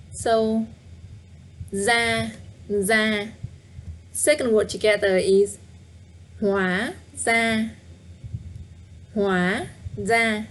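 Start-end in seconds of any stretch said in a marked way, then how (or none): 6.4-10.4 hóa with a rising tone, ra level, slowly; slow and careful. Shown as a demonstration. Teacher slowly modelling hóa ra tones. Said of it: "Up tone, no tone".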